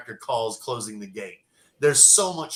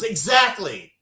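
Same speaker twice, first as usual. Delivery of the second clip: angry scolding; 4 semitones higher than usual. Retold or imitated. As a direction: put him in his place. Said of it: "And she goes".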